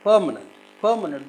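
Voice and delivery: "permanent" with a loud, quick PER; "permanent", strong first syllable and falling end; stress on first syllable. Shown as a demonstration. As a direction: punch the start of "permanent". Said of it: "The first syllable is stressed".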